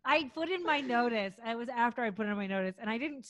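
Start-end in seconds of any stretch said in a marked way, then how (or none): none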